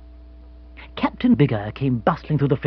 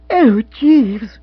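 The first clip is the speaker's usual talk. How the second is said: high, loud cry of disappointment; distressed exclamation; dismayed young woman; 10 semitones higher, 8 dB louder than usual. Acted. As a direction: wail it in a lifted female voice. Jill dismayed at the notice.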